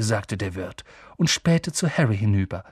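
usual speaking voice

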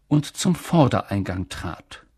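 ordinary delivery

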